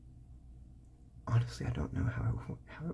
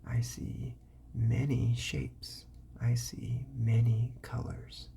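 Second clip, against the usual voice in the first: slow drill; slower than usual. Shown as a demonstration. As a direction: model each word slowly for a child.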